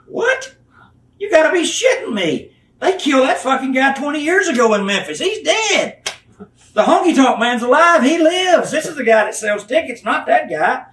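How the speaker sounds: outraged disbelief. Retold or imitated. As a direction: blow up in mock outrage at the idea. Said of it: "I said".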